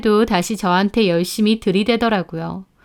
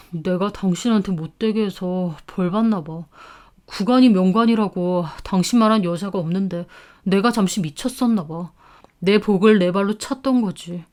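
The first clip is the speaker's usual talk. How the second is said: near-tearful ex-husband; 2 semitones lower, 3 dB quieter than usual. Acted. Ex-husband confessing regret to his ex-wife, softly near tears. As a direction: mumble it like he's close to tears. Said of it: "울듯한 얼굴로 한다는 말이".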